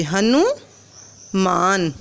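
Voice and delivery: slow teacher sounding-out; slow drawn-out word. Shown as a demonstration. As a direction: draw the word out for young learners. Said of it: "तो इसको हमें यहाँ पे लगाना है".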